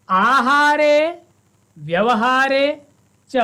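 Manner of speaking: slow, measured Sanskrit verse reading; verse recitation. Shown as a demonstration. Teacher reads the verse aloud to students, slow and measured. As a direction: recite the verse slowly, line by line. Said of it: "द्वितीयम Subhashitam पठामः।".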